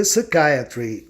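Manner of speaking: "psychiatry" slowly, with a stressed "eye" in the middle. Shown as a demonstration. Teacher giving English after Portuguese, slow "psychiatry" with stressed "eye". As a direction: stretch "psychiatry" as suh-KAI-uh-tree. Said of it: "o I especialmente ou excepcionalmente soa".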